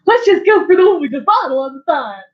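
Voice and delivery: loud, hammed-up imitation of Cricket; loud mock Cricket. Player mocking Cricket's choice, loud hammy voice. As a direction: boom it out like the big guy. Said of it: "he's like".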